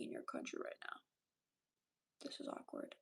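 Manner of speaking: a hushed "this is awkward" to the audience; whispered aside. Real, not acted.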